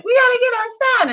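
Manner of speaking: panicky mom. Retold or imitated. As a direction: squeal it like a panicking mother. Mom panics over lost son.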